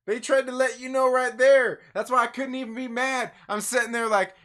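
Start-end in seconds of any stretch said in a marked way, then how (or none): 0.1-1.8 louder emphatic repeat. Real, not acted. Reviewer to viewers, insisting the clue was there, louder. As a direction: push it out harder and louder the second time.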